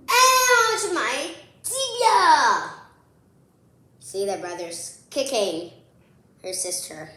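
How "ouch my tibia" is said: high, loud ouch, drawn-out tibia; hammed-up cry of a kicked shin; mock yelp of pain. Pretending the brother kicked her shin, loud mock ouch.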